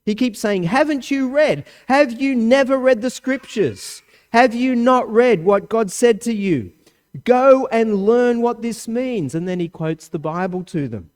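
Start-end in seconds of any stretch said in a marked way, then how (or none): 0.6-9.3 raised, insistent rebuke; Jesus rebuking. Retold or imitated. Jesus challenging the Pharisees, raised and insistent. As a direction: press the question hard at them.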